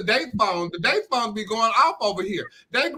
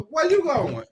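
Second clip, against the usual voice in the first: ordinary talk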